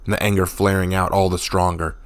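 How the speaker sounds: plain reading voice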